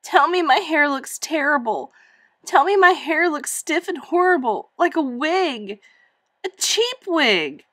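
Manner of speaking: high, loud, furious and close to tears; angry tearful outburst. Acted. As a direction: burst out angry and crying. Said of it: "she burst out in anger and in tears".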